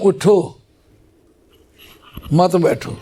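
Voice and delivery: اٹھو on its own, long pause, then مت بیٹھو; pause after اٹھو. Shown as a demonstration. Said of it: "اٹھو پر میں نے وقف کر لیا".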